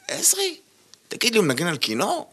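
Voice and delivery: high, startled question; surprised. Retold or imitated. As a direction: retell the disbelief with a leap up. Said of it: "אמרתי".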